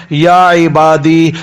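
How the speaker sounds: very slow, stretched "یا عبادی"; drawn-out call. Acted. Preacher voicing God's call to his servants, slowly drawn out. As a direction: stretch each syllable of "یا عبادی".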